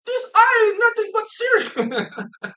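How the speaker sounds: loud, high, drawn-out mock amazement; imitating amazed shopper. Girl in the cereal aisle video, imitated amazement. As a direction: squeal it like an astonished shopper.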